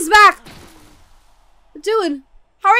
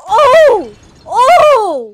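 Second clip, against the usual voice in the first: shocked gasp; 4 semitones higher, 10 dB louder than usual. Real, not acted. Gasping at a shock.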